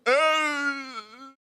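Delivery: a short shouted grunt of pain from a blow. Retold or imitated. Boy knocked down by mother's slap, with a pained shouted grunt. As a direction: let out a hit grunt. Sound of getting hit.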